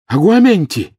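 loud, high spell cast; exclaimed spell. A narrator's reading. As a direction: cry out the incantation. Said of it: "ещё раз воскликнул Гарри". (возглас)

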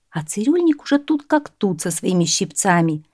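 ordinary delivery, nothing marked

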